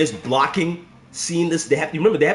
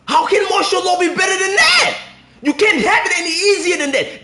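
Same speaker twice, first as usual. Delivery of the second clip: outraged shouting; 12 semitones higher than usual. Real, not acted. Streamer yelling at a combo that makes Law look weak. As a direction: shout it at the screen in disbelief.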